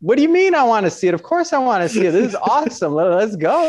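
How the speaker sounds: thrilled. Retold or imitated. Telling his client he must see the house, thrilled. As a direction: gush like you can't wait to go. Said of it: "I was like".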